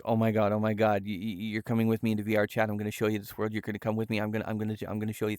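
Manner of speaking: thrilled friend. Retold or imitated. Buddy eager to show him VR chat, imitated thrilled rush. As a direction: rush it out, tripping over repeated words. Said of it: "he was so thrilled. He's like".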